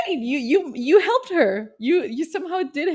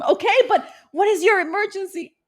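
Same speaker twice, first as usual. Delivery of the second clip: impatient operator; 9 semitones higher, 3 dB louder than usual. Pretend. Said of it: "I can imagine the call".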